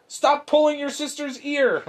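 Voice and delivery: high-pitched parental scolding; yelling at kids. Retold or imitated. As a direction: re-enact telling off a child. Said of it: "I yell at my kids".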